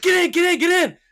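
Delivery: high, loud frantic yelling; frantic urging. Retold or imitated. Molly to her friends from the driver's side, frantic. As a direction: yell it as if the getaway car is moving.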